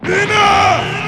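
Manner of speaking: high, loud roar; thunderous high cry; furious shout. A narrator's reading. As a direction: silence her with a royal roar. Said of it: "Luna's voice literally shook the heavens".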